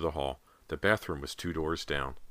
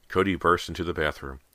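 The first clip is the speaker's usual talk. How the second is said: usual speaking voice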